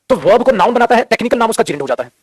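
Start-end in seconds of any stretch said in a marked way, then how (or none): none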